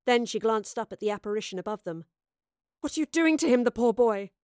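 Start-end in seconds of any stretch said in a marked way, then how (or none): 2.8-4.3 angry. Acted. Soothsayer confronts Astrid.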